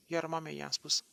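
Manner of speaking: usual speaking voice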